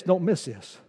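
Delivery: ordinary talk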